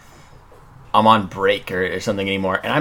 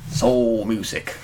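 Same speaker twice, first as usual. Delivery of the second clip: mock soulful voice; 3 dB louder, much slower than usual. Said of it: "to make it be like".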